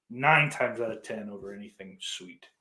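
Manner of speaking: usual speaking voice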